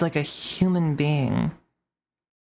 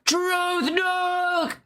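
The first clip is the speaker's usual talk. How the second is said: high, hammed-up cheering as the chat; mock chat hype; 14 semitones higher, much slower than usual. Mocking the chat cheering a bad take, high and hammed-up. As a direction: squeal it like a dumb hyped crowd. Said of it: "the chat's just like".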